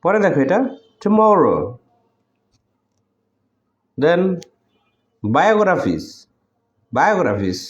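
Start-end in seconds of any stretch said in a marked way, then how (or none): 1.0-1.6 tomorrow with মরো raised, then falling; careful model of tomorrow, weight after টু; stressed syllable demo. Shown as a demonstration. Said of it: "ঠিক একইভাবে". 5.2-7.6 biographies said distinctly with a falling end; careful, emphatic biographies, repeated; clear stressed pronunciation. Shown as a demonstration. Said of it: "স্পষ্ট এবং জোর দিয়ে উচ্চারণ করতে হয়".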